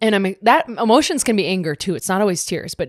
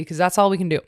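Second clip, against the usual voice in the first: ordinary talk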